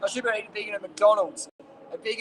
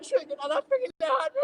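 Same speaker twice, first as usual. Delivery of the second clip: high, whiny, hammed-up panic; mock a critic cracking into fake crying; mock freak-out. Vlogger mimicking his diet critics, hammed-up freak-out.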